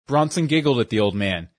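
plain reading voice

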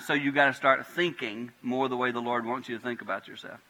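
ordinary talk

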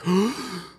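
a sudden high gasp as the needle hits; sharp gasp of pain. Retold or imitated. Tattoo client gasping as the needle hits the crease. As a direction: suck in air like it hurts. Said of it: "you're like".

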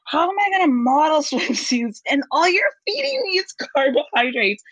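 high, laughing retold protest; hammed-up complaint, pitched high; mock exasperation. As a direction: wail it in playful despair. Retold complaint about food on shoot.

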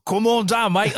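a playful Australian host's welcome; Aussie accent. Pretend. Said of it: "I don't know why I did the Aussie accent".

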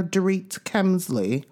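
usual speaking voice

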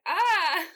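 loud, high panicked ah; startled cry of panic over the script; panicked yelp. Retold or imitated. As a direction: let out a sudden yelp of alarm. Panicking reading script in trailer.

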